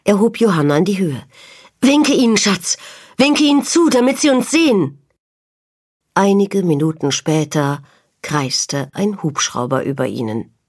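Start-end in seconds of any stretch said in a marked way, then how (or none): 1.8-5.0 put-on high, raised voice urging a child; loud, high-pitched plea; urgent calling out. A narrator's reading. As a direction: call out urgently to be rescued. Father calling, urging child to wave.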